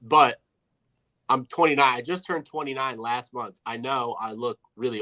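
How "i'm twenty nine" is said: ordinary talk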